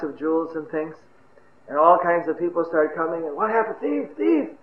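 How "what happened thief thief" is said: crowd alarm. Acted. People running up to join the chase, mild alarm. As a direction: pitch climbs from the question into the cry.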